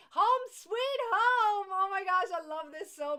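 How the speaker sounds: loud, high, drawn-out exclamation; gushing delight. Real, not acted. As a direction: belt it out with adoring excitement. Gushing over the blanket she loves.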